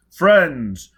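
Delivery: loud, grand, high declaiming; booming out like a pompous orator; earnest oration. Acted. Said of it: "Four Mile began earnestly".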